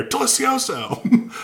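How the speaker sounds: high, drawn-out yell; imitated outburst, loud and slow; yelled into thin air. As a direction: whip round and holler it at nobody. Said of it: "yells into thin air".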